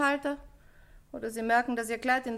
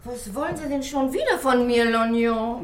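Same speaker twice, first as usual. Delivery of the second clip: louder, annoyed call through the door; irritated calling out; 6 dB louder than usual. Acted. Dressmaker woken early calling down to the inspector, loud and irritated. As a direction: shout down, annoyed at being woken.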